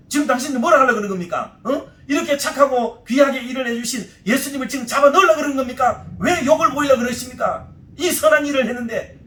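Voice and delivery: acted outraged questioning, pushed hard; angry attack. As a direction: take them on like a fighter defending someone wronged. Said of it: "막 하면서 그 사람을 막 공격하는".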